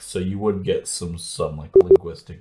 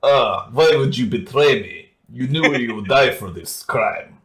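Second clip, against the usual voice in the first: mock Russian villain accent; hammy Russian villain; 4 semitones higher than usual. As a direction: ham up a Russian bad guy. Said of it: "both the villain voices were like".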